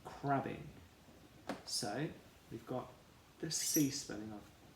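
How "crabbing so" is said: usual speaking voice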